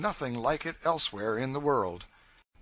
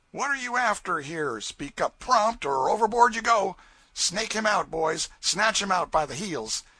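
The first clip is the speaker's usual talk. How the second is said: threatening raftsman; 8 semitones higher, 5 dB louder than usual. Acted. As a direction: threaten to toss him overboard.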